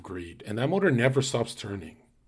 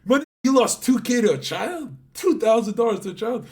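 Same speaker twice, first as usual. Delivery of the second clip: much higher, teasing squeal; mocking disbelief; 10 semitones higher, 5 dB louder than usual. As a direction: needle him for losing to a kid. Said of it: "And I'm like".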